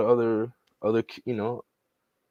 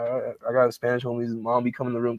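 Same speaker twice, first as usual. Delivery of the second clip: ordinary talk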